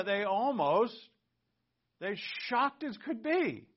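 high, loud, drawn-out exclamation; emphatic amazement. Real, not acted. Preacher describing the disciples' shock, loud amazement. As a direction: blurt it high and loud, stretching it.

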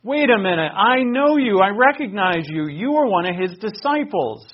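loud, high, pointed accusation; startled shout of recognition; accusing cry. Acted. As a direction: call him out in front of everyone. Servant girl accuses Peter.

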